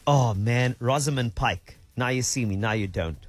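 ordinary talk, nothing marked

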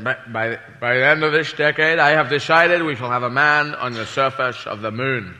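lightly voiced Kennedy. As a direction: address the nation gravely. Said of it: "President Kennedy said".

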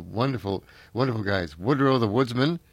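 usual speaking voice

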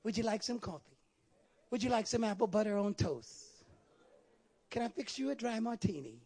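soft, courteous host's offer; quiet parody of a gracious host; mock polite hospitality. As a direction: offer refreshments gently, in mockery. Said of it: "We engage in a conversation with him".

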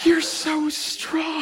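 very high, drawn-out swoon; swooning admiration. Acted. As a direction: gush slowly in a high mock-adoring voice. Acted swooning admiration of girlfriend.

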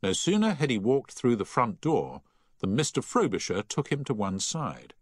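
ordinary delivery, nothing marked

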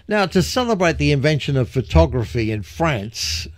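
ordinary talk

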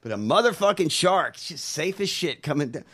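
ordinary talk, nothing marked

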